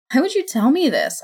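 cracking, upset voice. Acted. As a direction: let the voice crack with hurt. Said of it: "my voice cracking".

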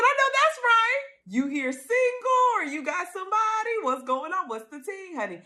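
hyped-up quote. Retold or imitated. Cohost cheering Sonya's questioning, high and hyped. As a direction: hype up your girl like a wingwoman. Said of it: "I said".

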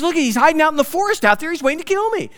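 high, fast, frantic; panicked Saul. Retold or imitated. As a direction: voice Saul's rising panic. Preacher voices paranoid Saul.

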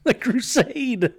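slow, high, stretched-out delivery; drawn-out mock line. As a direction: stretch each word out, mocking it. Mocking repeat of the movie line.

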